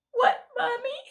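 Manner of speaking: put-on high, tearful little girl voice; a small child asking through sniffles; little girl sniffling. A narrator's reading. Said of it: "Sophia asked, still sniffling".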